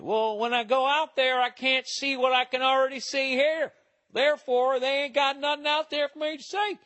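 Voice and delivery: mock a Southern yokel in a high twang; Southern accent. Mocking people who say nothing's out there, in a Southern accent. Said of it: "I shouldn't have done that in a Southern accent".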